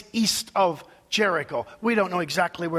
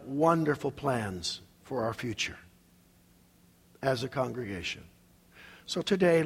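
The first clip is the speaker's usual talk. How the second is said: hushed prayer, sinking lower and softer; 7 semitones lower, 5 dB quieter than usual. Real, not acted. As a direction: pray reverently, letting the voice settle down low. Leads a closing prayer.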